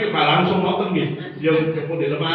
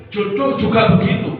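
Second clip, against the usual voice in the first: louder, higher push on jodoh; raised preacher voice; preaching emphasis; 5 semitones higher, 8 dB louder than usual. Real, not acted. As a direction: hammer the point home to the audience. Preacher stresses a point.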